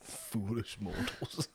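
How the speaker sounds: very slow, soft eerie whisper-drawl; slow theatrical villain menace. Retold or imitated. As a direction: hush it out like a ghost host. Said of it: "it's from the Haunted Mansion".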